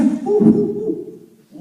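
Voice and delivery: high ape hooting; chimp hoots. Acted. Chimp answering the zoo guy, high hoots. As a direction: hoot like an excited chimp.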